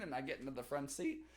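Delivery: ordinary talk, nothing marked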